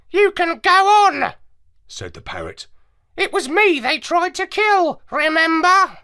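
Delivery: high squawky parrot, explaining; lecturing like a clever parrot. Acted. Parrot lectures the dog narrator, high and squawky.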